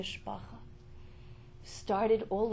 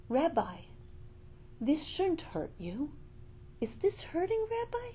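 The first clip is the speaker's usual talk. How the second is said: higher, lightly voiced concerned nurse; 7 semitones higher than usual. Nurse asks crying patient about pain, concerned. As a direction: lift it into a worried question, gently imitating a nurse.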